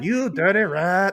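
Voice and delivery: put-on high, slow old-movie gangster; loud, hammed-up gangster imitation; James Cagney impression. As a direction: drag it out like a film tough guy. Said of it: "his best James Cagney voice".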